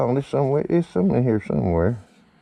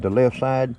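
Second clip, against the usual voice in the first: ordinary talk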